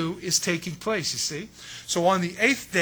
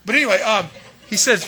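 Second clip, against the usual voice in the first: laughing; 3 semitones higher, 9 dB louder than usual. Real, not acted. Laughs after failing the voice.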